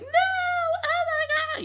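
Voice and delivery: very high, drawn-out squeal; mock fangirl scream. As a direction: imitate screaming kids at a premiere, stretched out without full volume. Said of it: "screaming, being like".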